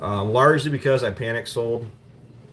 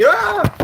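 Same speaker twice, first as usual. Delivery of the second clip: sudden yelp; 8 dB louder than usual. Real, not acted. Sudden outburst mid-sentence.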